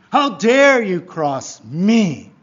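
loud, slow, drawn-out challenge; indignant outrage. Acted. Self-important person confronts whoever crossed them, outraged. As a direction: act mortally offended, leaning on each word.

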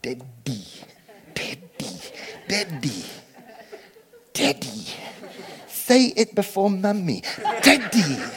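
put-on dad coaxing his baby at photo time, gently coaxing; coaxing baby to say daddy. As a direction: coax a baby into saying daddy. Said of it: "he'd be going to the photographs".

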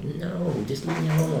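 high-pitched mock-exasperated brush-off; retold pestered protest; exasperated refusal. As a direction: act out fending off a nag. Retold refusal of nagging friend.

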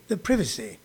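British short-i privacy, softly; English pronunciation. Retold or imitated. Comic showing listeners how England says it, British pronunciation. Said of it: "they say it in England".

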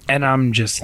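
usual speaking voice

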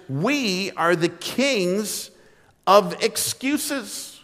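we are the kings of excuses, slowed right down; slow emphasis. Shown as a demonstration. Pastor admitting the obvious to his church, very slow. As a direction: drag it out, word by word. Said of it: "I'm just gonna say the obvious".

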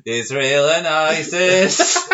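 slow, grand mock announcement; mock duo intro. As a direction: spell it out like a show title.

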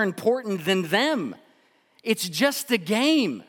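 high, loud preaching at full force. Acted. Pastor driving home the golf lesson, loud preaching. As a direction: drive the point home to the congregation.

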